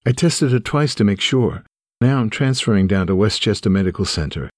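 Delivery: usual speaking voice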